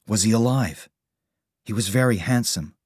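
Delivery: plain reading voice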